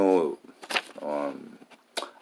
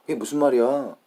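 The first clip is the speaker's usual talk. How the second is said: Korean phrase pitched high and louder; native Korean intonation; 8 semitones higher, 7 dB louder, much faster than usual. Shown as a demonstration. Learner repeating exactly what was heard, high Korean intonation. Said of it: "that's how you say it".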